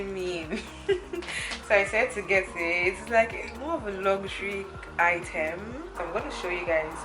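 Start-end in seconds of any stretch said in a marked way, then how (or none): 0.7-1.6 short breathy laugh; a quick amused laugh; light laugh. Real, not acted. Laugh after the quote.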